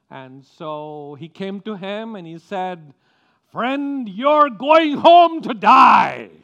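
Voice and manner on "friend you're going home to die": loud, drawn-out, high mock Australian accent; bad Australian accent. Surgeon from Australia to his patient, bad Australian accent. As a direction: belt out a hammed-up Aussie accent, very slow. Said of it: "very bad, um, um, copying of an Australian accent".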